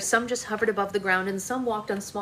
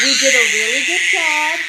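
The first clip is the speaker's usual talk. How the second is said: cheering praise; 5 semitones higher, 11 dB louder than usual. Real, not acted. Praising the child.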